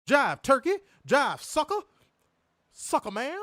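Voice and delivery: high, loud mock movie promo; exaggerated jive-talking impression; blaxploitation heroine parody. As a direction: punch it like a hammed-up seventies action heroine. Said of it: "talk like she's fresh out of a Pam Grier movie".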